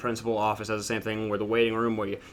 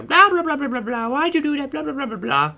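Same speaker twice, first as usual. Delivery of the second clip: mocking scolding adults; 13 semitones higher than usual. Playground duties scolding the kid, high mock nagging. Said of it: "They huddle me, and they're".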